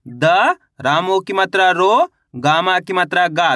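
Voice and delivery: द alone, रो stretched upward, गा falling; letter-by-letter spelling. Shown as a demonstration. Teacher sounds out a word for pupils, piece by piece, रो rising and गा falling. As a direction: spell it in pieces, lifting रो and dropping गा. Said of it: "अब उच्चारण किस तरीके से करेंगे".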